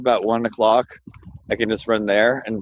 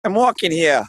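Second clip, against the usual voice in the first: New York accent; 5 semitones higher than usual. Shown as a demonstration. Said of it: "doing his New York impression".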